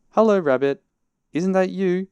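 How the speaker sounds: high, loud hailing voice; calling out. A narrator's reading. Pooh hailing Rabbit down the hole, calling out. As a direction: hail a friend high and loud.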